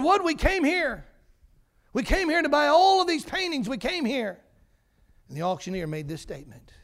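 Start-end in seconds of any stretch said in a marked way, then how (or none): 0.0-4.4 crowd protest. Retold or imitated. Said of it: "They said".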